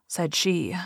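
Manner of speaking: usual speaking voice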